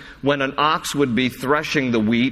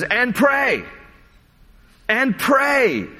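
high, insistent preaching, key words drawn out; emphatic; 9 semitones higher, slower than usual. Acted. Preacher urging the congregation to pray, emphatic. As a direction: press the call to prayer, pitched high and stretched. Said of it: "this is the emphasis".

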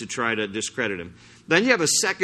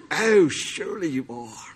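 put-on British accent, buttering him up; mock British flattery. Pharisees' disciples buttering up Jesus, mock British flattery. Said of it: "I just went British on that".

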